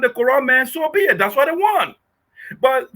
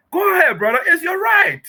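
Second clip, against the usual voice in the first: shout; 7 semitones higher than usual. Real, not acted. Taunting opponent, shouting.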